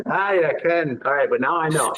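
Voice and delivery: a hint of a Scottish accent on I, I can; lightly imitated Scottish accent; mock Scottish. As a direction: try on the Scottish lilt playfully. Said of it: "in Scotland and someone said that to me".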